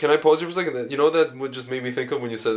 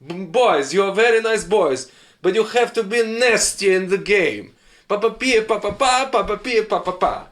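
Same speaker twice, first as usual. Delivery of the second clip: put-on higher-pitched Portuguese-accented English, emphatic sing-song; Jose Mourinho; 4 semitones higher than usual. Soccer coach telling his boys to be nasty, higher Portuguese accent. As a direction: lecture the team in a Russian-sounding Portuguese accent. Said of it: "Jose Mourinho speaking English".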